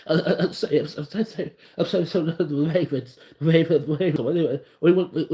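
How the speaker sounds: halting uh-uh-say stammer, lightly voiced; mock stammering Lamar Jackson. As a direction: stumble over every word like a shy quarterback. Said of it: "with his non-speaking ass".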